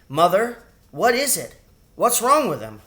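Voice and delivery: put-on alarmed child pleading; frightened boy. A narrator's reading.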